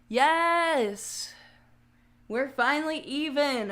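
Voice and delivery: loud triumphant yes; excited cheer. Real, not acted. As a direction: cheer like you just won. Celebrates the ears finally matching.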